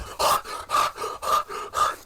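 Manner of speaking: exaggerated mock panting; mocking gasps. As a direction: pant hard to make fun of him. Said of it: "[name] was just mocking him".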